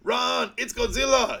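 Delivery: high, slow hammed-up cry of alarm; mock panic yell. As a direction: yell it like a B-movie extra fleeing. Acting out panicked movie line.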